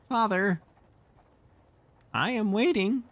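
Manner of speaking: put-on high, cold little-boy voice; cold child. Michael waits for his father, high and cold. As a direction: say it icily, like a stubborn small boy. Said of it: "said Michael coldly".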